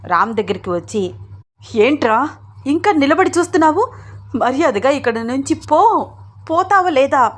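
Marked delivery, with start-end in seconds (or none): 1.8-7.3 high-pitched furious demand; acted angry yell, pushing him out. A narrator's reading. Tearful mother orders Ram to leave, yelling. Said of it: "అని అరుస్తూ".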